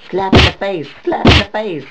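loud, high silly character voice; shouting character voice. Acted. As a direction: yell it like a wild sidekick.

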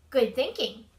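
usual speaking voice

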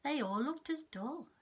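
put-on lively read-aloud for small children; story narration. Teacher reading a picture book to her class. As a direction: tell it like a bedtime storybook.

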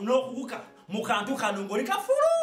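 ordinary talk

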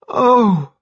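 high, delighted gasp of "oh"; soaring rapture; ecstatic cry. A narrator's reading. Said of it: "she cried in an ecstasy".

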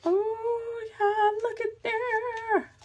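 very high, slow, delighted cooing; high squeal. Real, not acted. Squealing at discovery.

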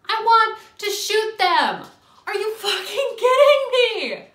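loud, high, slow disbelief with a laugh; outraged shout. Real, not acted. Vlogger venting at a rude stranger, outraged shout.

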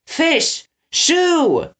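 very high-pitched call to friends; cried out. A narrator's reading. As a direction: cry out their names. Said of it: "Eggs cried".

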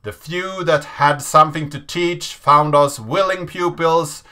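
intense narration over music; dramatic reading. A narrator's reading. Reading the Hacker Manifesto to viewers, intense and dramatic. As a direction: read it like a defiant manifesto.

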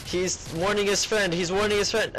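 high-pitched mock alarm in a fake accent; hammed-up urgent play-by-play; urgent commentator. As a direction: call out the danger like an excited commentator. Mock commentary as danger nears.

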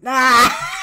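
loud burst of a yelp; startled shriek. Real, not acted. Commentator reacting to a hairline photo, loud shriek. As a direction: shriek out, then collapse into laughter.

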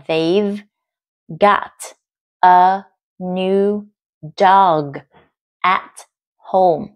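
very slow, word-by-word dictation; careful, spaced-out reading; slow answer read-out. Shown as a demonstration. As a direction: separate each word for learners. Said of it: "Đáp án của câu này".

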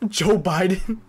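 put-on slow, laughing old-man voice; drawn-out old-man question; Biden impression. Streamer voices Biden asking chat. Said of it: "Was that a good Biden impression?".

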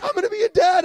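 high, loud happy announcement; thrilled new dad. Retold or imitated. Husband shouting the news of becoming a dad, high. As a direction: announce it, bursting with joy.

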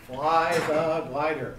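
"Fly" leaned on, whole phrase slowed right down; slow emphatic repeat. Shown as a demonstration. Instructor hammering the key rule home to students, slow and heavy. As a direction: drive home each word of "Fly the glider". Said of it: "I can't emphasize this enough".

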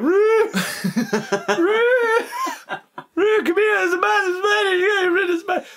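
very high, loud shriek for help, re-enacted; hammed-up terrified squealing yell; panicked shout. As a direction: scream for a mate in mock terror, voice way up high. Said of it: "I shouted".

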